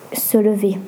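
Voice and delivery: plain reading voice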